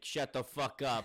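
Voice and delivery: loud, slow mock rebuke; angry captain. Captain silencing doubting crew, hammed-up anger. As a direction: put the crew in their place, loudly.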